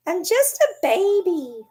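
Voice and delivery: put-on high, slow whiny baby; pouty baby voice. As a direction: whimper it like a spoiled pup. Voicing the sulking dog.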